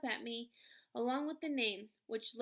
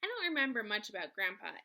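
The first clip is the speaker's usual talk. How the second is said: usual speaking voice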